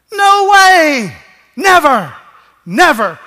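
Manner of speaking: high, loud cry of No way, then Never twice, falling; thundering refusal; emphatic shout. Real, not acted. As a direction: shout the denial from the pulpit. Preacher's emphatic denial.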